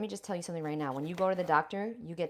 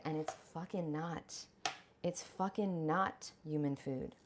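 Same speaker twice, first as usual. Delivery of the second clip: quiet slow emphasis on fucking; slow, softer emphatic "fucking not"; 5 dB quieter, slower than usual. Real, not acted. Low slow emphatic insistence.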